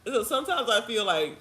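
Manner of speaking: ordinary talk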